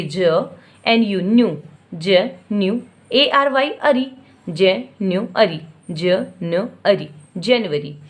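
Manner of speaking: ज, न्यू, अरी drawn out slowly then joined as जनवरी; slow teacher demo of syllables; slow syllable sounding. Shown as a demonstration. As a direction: stretch each chunk of जनवरी for a child. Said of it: "बच्चे को यहाँ पे sound कराना".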